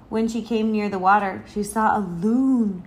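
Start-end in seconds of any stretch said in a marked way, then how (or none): none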